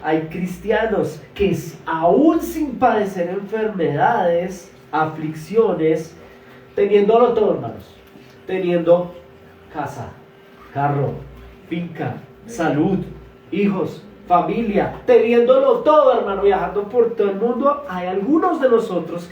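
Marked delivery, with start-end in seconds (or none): 1.8-15.0 each word stretched and pushed louder; slow, louder sermon emphasis; slow emphatic preaching. Real, not acted. Preacher stressing examples slowly. 15.1-16.2 higher and louder, peak of the sermon; loud high preaching cry; preaching shout. Real, not acted. Preacher peaks on key phrase.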